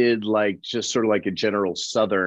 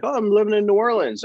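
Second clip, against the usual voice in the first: higher quick voice of another guy; higher character voice; 11 semitones higher, faster than usual. Retold or imitated. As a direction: lift the voice for the other actor. Rival answers casually.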